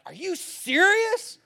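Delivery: high, loud mock outrage; hammed-up offended protest; indignant. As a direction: act personally affronted, voice shooting up in disbelief. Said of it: "indignant is like".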